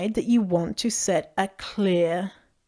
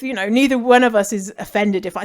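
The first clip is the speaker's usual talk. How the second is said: usual speaking voice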